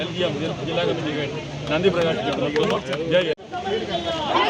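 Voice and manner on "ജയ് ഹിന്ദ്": drawn-out slogan. Real, not acted. Closing slogan of the speech.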